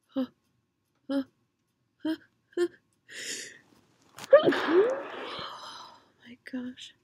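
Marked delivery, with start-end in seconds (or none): 4.4-6.9 whisper. Real, not acted.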